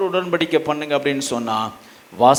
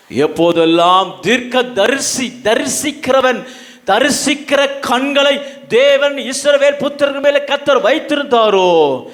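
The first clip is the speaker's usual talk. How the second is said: high, ringing sermon delivery, each phrase driven home; full-force preaching; 9 semitones higher, 6 dB louder than usual. Real, not acted. Preacher quoting scripture on wayward Israel, full-force preaching. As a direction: proclaim it to the whole congregation.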